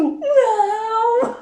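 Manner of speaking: a high, wailing hammed-up "no" at the dirty tiles; mock crying wail. Retelling crying in the shower at the dirty tiles, mock wail. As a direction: cry it out like a retold breakdown.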